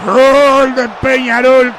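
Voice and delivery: slow, stretched, loud goal cry; long goal shout. Real, not acted. Goal scored, commentator shouts.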